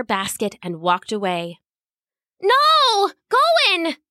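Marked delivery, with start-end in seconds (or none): 2.4-4.0 very high, loud anguished call; high, loud desperate call after her friend; desperate cry. Acted. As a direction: cry out as he's carried off. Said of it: "she called".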